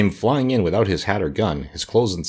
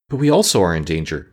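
plain reading voice

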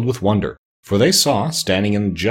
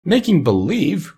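high, drawn-out incredulous question; astonished cry; 10 semitones higher, slower than usual. A narrator's reading. Dorothy hears the wizard's confession, crying out astonished. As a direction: cry it back slowly, pitched way up. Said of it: "cried Dorothy".

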